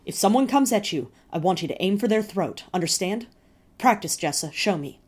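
loud, urgent commands; mother's loud fierce instructions; fierce order. A narrator's reading. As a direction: drill them hard, no patience. Mother drilling terrified children.

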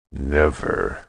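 low, quiet, ground out through teeth; through clenched teeth. A narrator's reading. Stan refusing Yuri's threat, ground through clenched teeth. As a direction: grind the word out, barely opening the jaw. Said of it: "Stan ground the word between his clenched teeth".

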